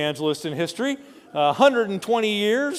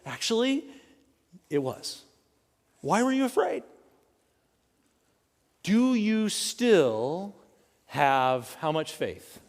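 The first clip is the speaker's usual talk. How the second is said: gentle, understated Jesus; 5 dB quieter than usual. Acted. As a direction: ask it quietly, letting it sink in. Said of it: "Jesus says".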